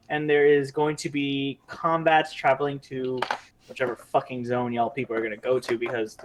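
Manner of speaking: ordinary talk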